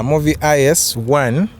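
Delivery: usual speaking voice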